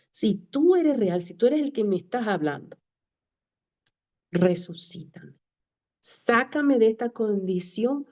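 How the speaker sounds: slow, insistent retold prayer; measured pleading, retold; retold plea. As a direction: re-enact begging God, drawing each phrase out. Desperate prayer to God in car.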